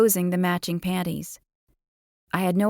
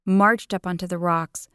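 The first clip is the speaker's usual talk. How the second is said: plain reading voice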